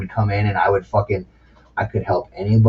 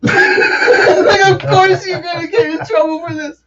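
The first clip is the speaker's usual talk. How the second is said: high, breaking up with laughter; incredulous reply squealed through laughs; laughing hard; 3 dB louder, slower than usual. Real, not acted. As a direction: barely get it out for laughing. Cracking up at the retold question.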